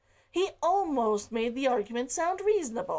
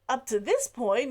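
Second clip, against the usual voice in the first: ordinary delivery, nothing marked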